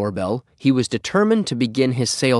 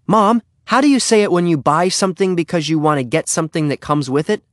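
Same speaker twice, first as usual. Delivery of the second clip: put-on higher, questioning kid-character voice; eager Henry; 7 semitones higher, 4 dB louder than usual. Henry asks his mom for a sales-talk word, higher and puzzled. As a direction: ask it in a raised, puzzled voice.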